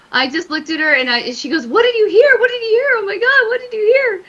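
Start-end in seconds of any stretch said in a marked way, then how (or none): 1.7-4.2 panicked friend. Retold or imitated. Friend panicking in haunted room.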